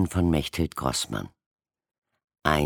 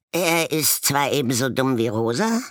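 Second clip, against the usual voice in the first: high, louder grudging voice; grudging raven; 10 semitones higher, 4 dB louder than usual. A narrator's reading. Yellow-spotted raven grudgingly agreeing, high voice. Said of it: "meinte ein Gelbgetupfter".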